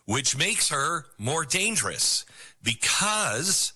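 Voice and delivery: ordinary delivery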